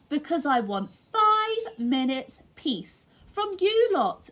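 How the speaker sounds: put-on building exasperation at the kids; fed-up mum. A narrator's reading. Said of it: "said Missus Large".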